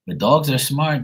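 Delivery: ordinary talk, nothing marked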